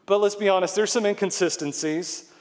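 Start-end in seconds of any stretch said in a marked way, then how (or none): none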